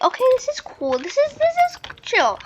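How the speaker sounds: high-pitched retold self-talk; exaggerated high voice insisting all is fine; acted chill reaction. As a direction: pitch it way up, playfully. Acted reaction at the other park.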